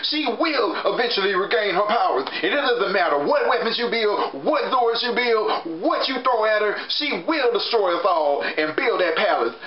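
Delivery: lightly voiced frantic warning, pitched high; hammed-up alarm over the queen; mock panic. [name] warns the Rangers about the queen, in mild mock panic. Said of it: "[name] was just freaking out".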